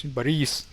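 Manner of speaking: "Boris" with a long stressed "ee", ba-REES; "Boris" the Russian way, weight on the end; second-syllable stress. Shown as a demonstration. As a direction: stretch the last vowel of "Boris". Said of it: "The emphasis is on E".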